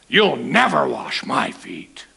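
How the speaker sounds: slow, firm refusal, mock indignant; vehement protest. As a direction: protest hard, stretched out. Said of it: "Vehemently protested".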